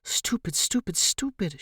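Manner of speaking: frustrated, scolding herself; cursing herself under breath; self-cursing. A narrator's reading. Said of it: "she cursed herself".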